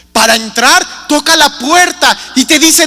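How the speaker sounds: usual speaking voice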